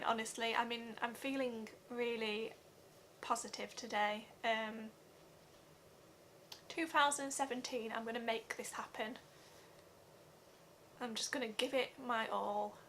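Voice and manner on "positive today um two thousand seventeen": usual speaking voice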